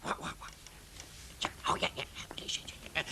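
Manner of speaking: whispering. Acted. Whispered plotting.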